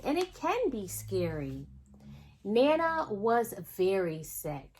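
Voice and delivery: usual speaking voice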